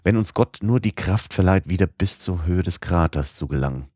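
plain reading voice

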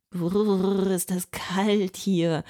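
breathy brrr, then slow shivering words; a mild, hammed-up freezing shiver; shivering with cold. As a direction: teeth chattering in the icy air. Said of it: "bibbert mit den Zähnen".

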